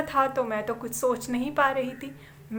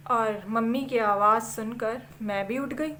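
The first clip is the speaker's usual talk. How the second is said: ordinary delivery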